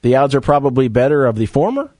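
questioning uptalk. Real, not acted. Radio host guessing which coaches might show up, questioning uptalk. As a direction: let each phrase swing up like a question. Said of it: "Why am I talking like this".

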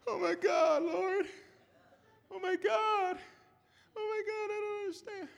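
high, fading cry of grief; a hint of a broken mourner calling on God; lightly acted grief, high and fading; distraught mourner. Voicing mourners crying to God.

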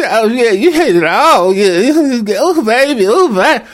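overdone bedroom moans; mock lover. Acting woman in bed.

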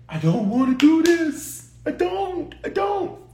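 high, pleading cry of reluctance; the voice pulled up high, begging not to; anguished plea. Retold or imitated. Preacher voicing Jesus in anguish, high pleading cry. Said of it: "Jesus' humanity said".

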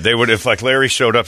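ordinary delivery

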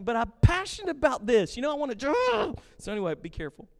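normal talking voice

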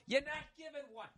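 very high, hushed comic aside; mock squeak. Comic answering hecklers about the crowd's performance, squeaky mock aside. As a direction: drop the volume and squeak it mockingly. Mocking crowd he supposedly disrupts.

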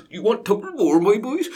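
a light imitation of a South London accent; mock South London accent. Host mocking how Hitler sounded to Berliners. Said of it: "talking like this".

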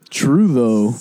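ordinary talk

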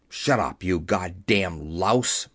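low, slow menacing put-down; angry threat. A narrator's reading. Young Tugwell striding at Keller, low angry threat.

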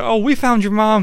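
a hint of sick cheer; mock cheerful reveal. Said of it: "It's like".

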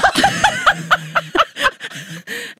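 long high delighted laugh; big laugh. Real, not acted. Cohost laughing after the bathroom saga, long high laugh. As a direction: howl with laughter at the story. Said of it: "that was an amazing ride".

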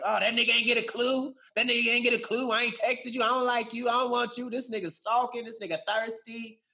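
imagined friend sneering at the texter, mocking and scornful. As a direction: ridicule the one who reached out. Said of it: "this person's gonna laugh about me".

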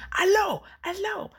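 very high-pitched hollered hello; squealed mock phone shout; mock screaming. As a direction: imitate someone yelling into a phone over noise. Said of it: "and they're screaming".

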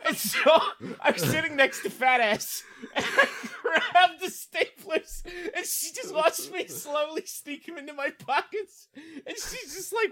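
slow, high, giggling retelling; laughing while telling. Real, not acted. Friend recounting stapler theft, laughing. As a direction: laugh your way through the heist story.

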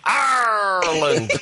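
"Ireland" as an overblown "Arrr-land"; mock mispronunciation. Host ridiculing panelist's way of talking, absurd long Arrr-land. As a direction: stretch the start of "Ireland" absurdly. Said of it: "you're a mental case".

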